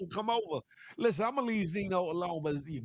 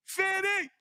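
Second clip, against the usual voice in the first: high shouted name; sudden high callout; calling out; 12 semitones higher, 3 dB louder than usual. Retold or imitated. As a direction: yell fifty up high. Calling out to [name].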